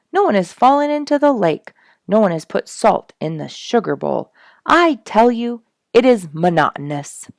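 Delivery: loud, high mock exasperation; animated complaint. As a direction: rant playfully about the boredom. Complains life is too orderly.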